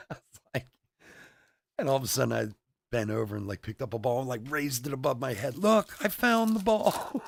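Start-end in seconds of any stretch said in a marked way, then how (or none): 5.6-7.2 high, loud, drawn-out mock shout; triumphant calling out. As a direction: holler it slowly, staging a big discovery for everyone. Triumphant shout in retold ruse.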